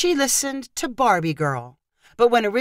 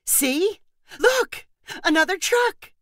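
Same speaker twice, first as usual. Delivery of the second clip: high, bright look-what-I-found; aunt excitedly showing the boy a new truck; eager cheer; 11 semitones higher, slower than usual. A narrator's reading. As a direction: sell it to a crying toddler. Megan cheerfully shows new truck.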